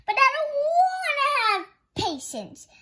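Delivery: high, whiny little protest; protesting child. Acted. Betty protests to her mother, high whine. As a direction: complain like a stubborn little girl. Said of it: "Betty Bunny protested".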